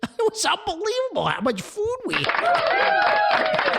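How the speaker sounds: high squealing laugh through the words; can barely get it out for laughing; giggling at the huge bill. Real, not acted. Laughing over huge food order.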